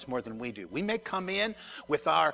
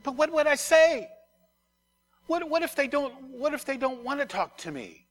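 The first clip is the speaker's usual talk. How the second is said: anxious protest; 10 semitones higher, 7 dB louder than usual. Acted. Anxious self answering the Spirit.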